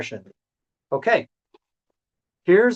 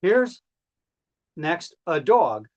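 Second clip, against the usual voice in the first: ordinary talk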